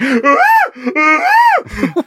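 very high wordless whoops; animal-like high cries, hammed up; wordless mock moan. Showing how to be loud without words, wordless mock cries. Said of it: "you don't have to say anything".

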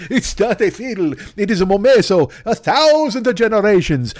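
put-on a light Italian accent on an old shopkeeper. Italian-accented shopkeeper refusing the American soldier, lightly voiced. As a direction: lightly play an Italian violin owner. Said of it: "me competing with Chris Pratt for the voice of Super Mario".

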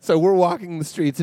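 usual speaking voice

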